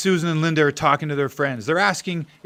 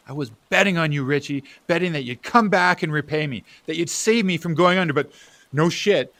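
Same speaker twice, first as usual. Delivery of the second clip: ordinary delivery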